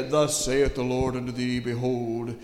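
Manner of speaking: a hint of a mock-solemn prophet; King James prophet. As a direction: intone it like old scripture. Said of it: "it's not gonna be a".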